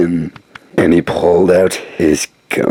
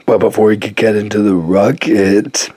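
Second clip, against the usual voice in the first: ordinary delivery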